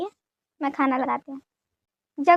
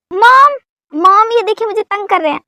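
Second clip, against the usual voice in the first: calling out, tattling; 5 semitones higher, 8 dB louder than usual. Acted. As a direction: holler for mom like a tattletale. Calls mom to tattle on husband.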